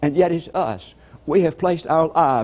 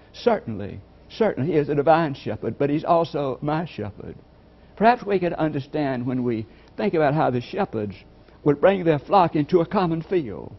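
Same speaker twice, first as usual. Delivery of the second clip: ordinary delivery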